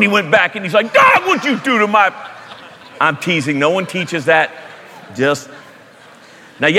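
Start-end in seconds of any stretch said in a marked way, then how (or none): 0.9-2.1 high, laughing mock complaint from a lightly voiced soul; mock outraged soul. Soul sent back to purgatory complains to God, laughing mock outrage. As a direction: whine it up at God through a laugh.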